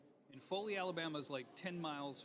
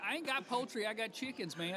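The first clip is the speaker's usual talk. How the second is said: higher-pitched argumentative caller; arguing caller; 6 semitones higher, 4 dB louder than usual. Retold or imitated. A caller argues with the radio host, indignant. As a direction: push back like an indignant caller. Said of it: "then they would argue with me".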